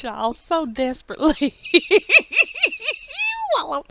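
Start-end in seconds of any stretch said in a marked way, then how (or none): 1.7-3.8 higher plea dissolving into high laughter; laughing plea. Real, not acted. Vlogger needing viewers among the liberals, pleading with laughter. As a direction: beg jokingly, then crack up.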